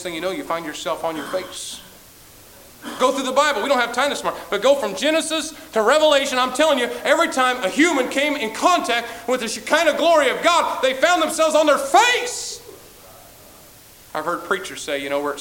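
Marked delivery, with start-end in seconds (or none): 3.0-12.6 high, loud pulpit cry, slowing at the end; booming sermon climax; full-force preaching. Acted. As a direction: drive it home like a revival preacher.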